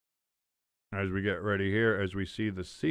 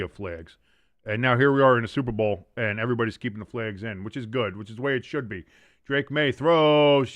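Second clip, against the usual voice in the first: play-by-play; 5 dB louder than usual. Acted. Play-by-play call of the game.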